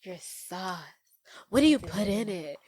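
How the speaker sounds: a hint of a temptress fishing for a secret; mock flirty seductress. Roxanne sweet-talks Ed for the sauce, lightly seductive and soft. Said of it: "she's like".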